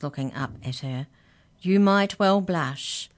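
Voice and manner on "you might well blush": slow, louder, higher chiding; knowing reproach. Acted. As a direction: draw it out to make the guilt sting. Mouse knowingly chides embarrassed girl.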